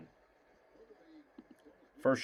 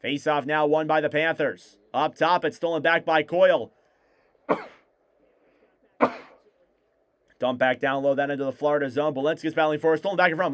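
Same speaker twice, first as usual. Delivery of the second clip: play-by-play. Acted. Live hockey play-by-play.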